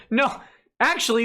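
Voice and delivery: ordinary talk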